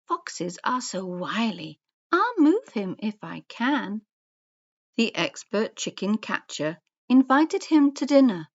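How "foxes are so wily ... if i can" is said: cunning fox. A narrator's reading. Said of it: "Now Roxy was so foxy, she had a cunning plan".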